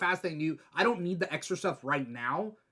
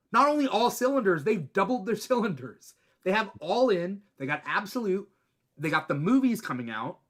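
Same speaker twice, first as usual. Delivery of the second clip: higher, emphatic rave; fired up; 4 semitones higher than usual. Real, not acted. Host raving about DC firing on all cylinders, fired up. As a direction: gush with rising enthusiasm, punching it out. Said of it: "I'm getting fired up right now".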